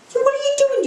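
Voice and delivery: high-pitched shocked granddaughter; scandalised, voice jumping up high; shocked scolding. Acted. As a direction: exclaim it high, aghast. Joke: granddaughter scolding her nan.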